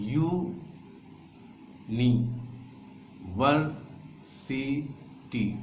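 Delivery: यू, नी, वर, सी, टी, each syllable alone with long pauses. Shown as a demonstration. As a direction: chop यूनिवर्सिटी into its syllables, one at a time. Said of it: "कितने syllables हैं?".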